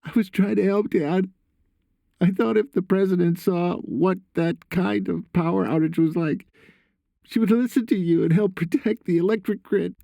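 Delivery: high, pleading son's voice; confessing son. A narrator's reading. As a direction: justify yourself to Dad in a high voice.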